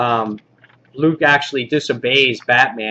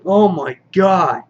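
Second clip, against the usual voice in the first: high, drawn-out awe; re-enacted amazement; 5 semitones higher, slower than usual. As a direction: relive being blown away. Retold reaction to shocking twist.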